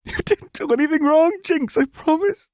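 very high, loud sobbing confession; tearful. A narrator's reading. Lux taking all the blame before Jinx, sobbing. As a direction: wail it through hot tears. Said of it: "tears fell in hot".

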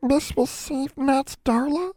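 very high, slow little elf voice; tiny voice. A narrator's reading. Nat timidly asks about saving Darla, tiny voice. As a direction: squeak it out slowly, small and timid. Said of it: "Nat asked in a tiny voice".

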